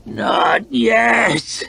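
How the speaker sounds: high, strained snarl of refusal. A narrator's reading. Failing fighter refusing to give in, snarling. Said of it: "he snarled".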